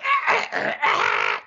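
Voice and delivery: harsh mouth-made modem squeal; dial-up modem imitation. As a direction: mimic an old modem connecting. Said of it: "and you hear, like".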